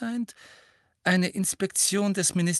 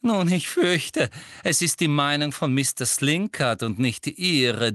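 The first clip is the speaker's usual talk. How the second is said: raised, higher, authoritative voice; stern rebuke; 5 semitones higher, 5 dB louder than usual. A narrator's reading. Teacher overrules Hermine before the class, stern and loud. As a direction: put the student in her place, loudly.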